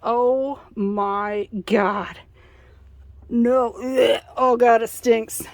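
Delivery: loud disgusted groans at a stink; recoiling from a rotten smell; smelling something awful. Real, not acted. Opens fridge, smells rot.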